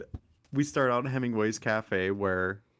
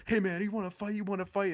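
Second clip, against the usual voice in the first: mock tough guy calling him out, pitched up; aggressive drunk guy; 10 semitones higher than usual. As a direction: imitate a guy picking a fight, higher. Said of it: "he's like".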